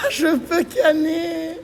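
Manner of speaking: high, slow words through laughter; crying with laughter. Real, not acted. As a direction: laugh until it hurts. Laughing fit.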